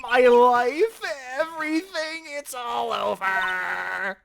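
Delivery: high drawn-out mock wail; despairing wail. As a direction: cry out it's over, hammed up. Client screaming offstage.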